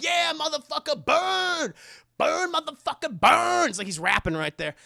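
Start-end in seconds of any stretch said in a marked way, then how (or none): 0.0-3.7 high, loud gloating chant; triumphant shouting. Retold or imitated. [name] gloating over burning [name], shouted chant. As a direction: chant it out like a victory rap. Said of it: "[name] is like".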